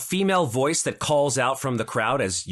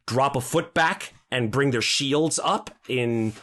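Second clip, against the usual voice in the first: ordinary talk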